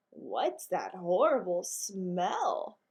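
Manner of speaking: slow, drawn-out disgusted lady dog; disgusted Maggie. Acted. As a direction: pull back from the stink, slowly. Maggie recoils from Henry's smell.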